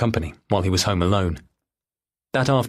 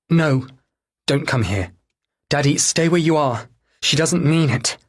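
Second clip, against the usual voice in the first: pleading daughter on phone; 6 semitones higher than usual. Acted. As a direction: plead with Daddy to stay put.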